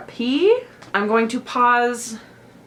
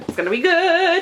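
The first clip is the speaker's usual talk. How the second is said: it's gonna be good said high and loud; excited squeal; excited, higher and louder; 10 semitones higher, 6 dB louder than usual. Real, not acted. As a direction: hype yourself up.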